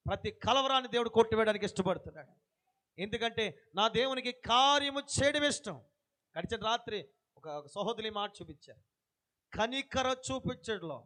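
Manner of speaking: normal talking voice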